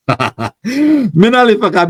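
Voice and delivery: laughing through the name, drawn out. Real, not acted. Host laughs reading a viewer's cheeky comment. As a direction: chuckle as you say her name.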